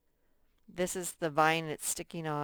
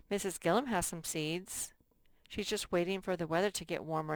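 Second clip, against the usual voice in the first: normal talking voice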